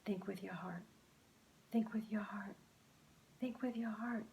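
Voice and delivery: soft unvoiced whisper. A narrator's reading. Luke whispers the phrase during the fight. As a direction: murmur it to yourself. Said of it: "started whispering to himself three times".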